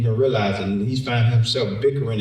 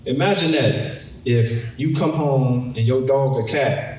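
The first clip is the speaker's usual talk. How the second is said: ordinary talk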